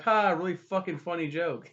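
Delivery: mock an angry horse's scornful reply; pissed off. Horse scoffing at two men joking in a bar, pissed off. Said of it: "like, super pissed off".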